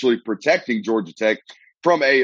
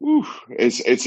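usual speaking voice

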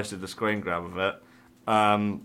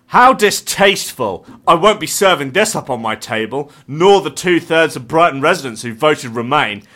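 indignant lady; 5 semitones higher, 8 dB louder than usual. Retold or imitated. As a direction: read it as a pompous offended lady.

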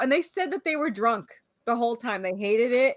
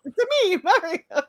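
high Mario catchphrase voice; 7 semitones higher than usual. Retold or imitated. Joking that Mario only says his catchphrase, high Mario voice. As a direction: pop it out high like Mario himself. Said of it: "Imagine that's all he said".

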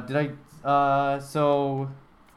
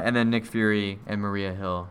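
ordinary talk, nothing marked

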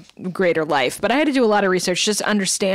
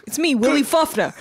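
sitcom character voice; 7 semitones higher than usual. Pretend. Mock catchphrase of sitcom figure.

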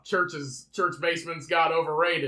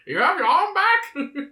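high, slow clown voice; Pennywise voice; 13 semitones higher, 6 dB louder, slower than usual. Acted. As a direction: taunt it in a high clown squeak. Pennywise quote continues.

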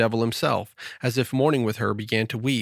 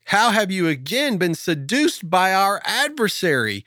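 reproachful Adam; 8 semitones higher, 4 dB louder than usual. Acted. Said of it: "he cried out with tears".

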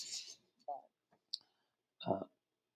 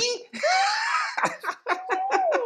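1 long high laugh. Real, not acted. Long laugh after a slip.

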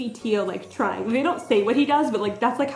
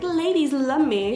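slow, higher, hammed-up swagger; show-off ladies' man; 4 semitones higher, slower than usual. Imagined flirt showing off to women, mock ladies' man swagger. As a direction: draw it out like a self-styled heartthrob. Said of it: "he's not purposely being like".

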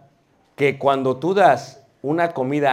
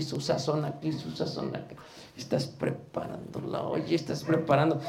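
whispered aside; 9 dB quieter than usual. Pretend.

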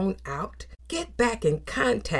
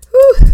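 high, loud exhaled whew; preacher letting out a loud whew; 14 dB louder than usual. Real, not acted. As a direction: blow out a big relieved breath. Exhale after preaching peak.

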